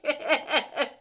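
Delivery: loud, high laughing disgust; laugh-scream in horrified delight; shrieking laugh. Real, not acted. Reacting to a gross Tinder message, loud laughing disgust.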